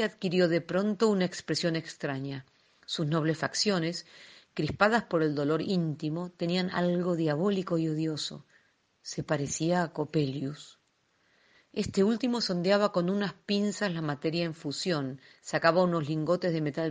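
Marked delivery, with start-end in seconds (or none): none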